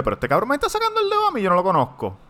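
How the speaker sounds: high, fast indignant outburst; outraged disbelief. Retold or imitated. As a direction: rattle it off in shocked disbelief. Retold outrage at the finger.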